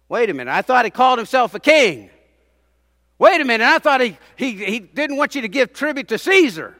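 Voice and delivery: loud, high, building preacher's cry; preaching at full force. Acted. Preacher exposing the leaders' real charge, building loud. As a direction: climb with each wait a minute.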